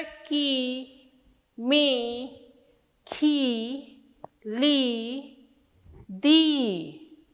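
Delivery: की, मी, खी, ली, दी each said alone; teacher drilling long ई sounds; isolated long-vowel syllables. Shown as a demonstration. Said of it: "उच्चारण में कितना अंतर".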